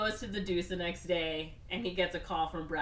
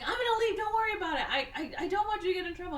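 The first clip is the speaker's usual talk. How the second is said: much higher, with laughter breaking through; mock earnest hero; 12 semitones higher, much faster than usual. Dalton telling Elizabeth he'll leave, high, laughing mock nobility. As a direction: play the noble hero while laughing at him. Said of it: "Um, he's like".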